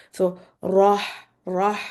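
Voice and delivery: "rah" with a heavy, thick r. Shown as a demonstration. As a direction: say "rah" with the tongue up, no rounding. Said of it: "your tongue should just go up for making it heavy".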